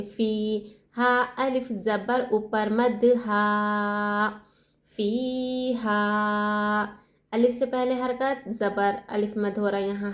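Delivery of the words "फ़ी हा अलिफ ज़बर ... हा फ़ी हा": very slow, हा drawn out one alif length; teacher drilling the alif madd; stretched फ़ीहा. Shown as a demonstration. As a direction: hold the हा of फ़ीहा long. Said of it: "हा को एक अलिफ की मकदार खींचेंगे".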